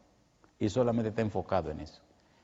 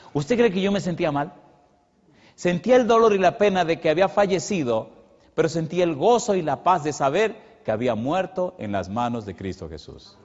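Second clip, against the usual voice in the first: louder, higher, rising to a fervent peak; impassioned preaching; 6 semitones higher, 5 dB louder than usual. Acted. Preacher recalling his mother's funeral, loud and fervent. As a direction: preach it with mounting fervour.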